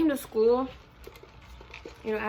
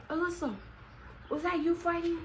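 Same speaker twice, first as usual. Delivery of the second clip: higher-pitched quote of an incredulous cop; mock surprised question; 8 semitones higher than usual. Cop questioning her about the fight, mock surprise. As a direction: imitate a startled cop asking.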